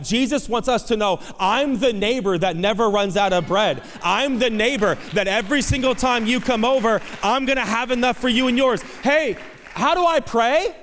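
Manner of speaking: high, loud preacher's proclamation; full-force preaching. Acted. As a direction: proclaim it to the whole congregation, pitched high.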